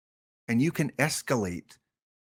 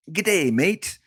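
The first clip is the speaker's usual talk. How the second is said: higher-pitched, hammed-up Aussie greeting; mock Australian accent; 6 semitones higher, 3 dB louder than usual. Streamer greeting viewers in Australia, mock Australian accent. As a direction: put on a playful Australian accent. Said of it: "If you're in Australia".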